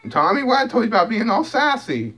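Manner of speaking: cutesy telling-off to a cat. Real, not acted. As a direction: scold Tommy playfully way up high. Scolding cat in baby voice.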